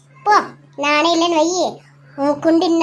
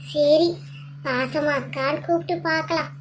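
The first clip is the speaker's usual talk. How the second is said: ordinary talk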